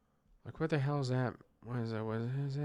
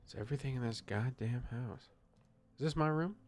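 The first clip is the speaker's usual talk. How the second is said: usual speaking voice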